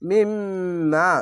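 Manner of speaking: মিম্মা with the doubled m hummed long through the nose; slow tajweed chant; nasal ghunna. Shown as a demonstration. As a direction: hold the nasal hum on মিম্মা, then stretch the a. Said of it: "ওয়াজিব গুন্না ... আলিফ টেনে পড়বেন".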